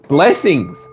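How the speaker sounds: ordinary talk, nothing marked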